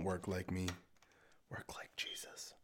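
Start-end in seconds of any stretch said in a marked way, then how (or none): 1.5-2.5 whispered punchline; whispering. Real, not acted. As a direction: whisper it like a secret. Joke advice to listeners.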